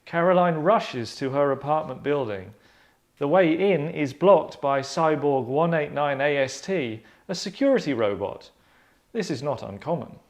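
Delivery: lightly dramatic story narration; sketch narration. A narrator's reading. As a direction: read the scene aloud like a radio play narrator.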